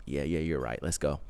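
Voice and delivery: very soft, low murmur; subdued agreement. Retold or imitated. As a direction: imitate someone quietly giving in, voice dropped. Acts Isaac's subdued agreement.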